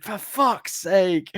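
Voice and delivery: slow, high exasperated groan of a curse; slow fed-up swear. Retold or imitated. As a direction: drag it out, fed up. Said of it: "He's like".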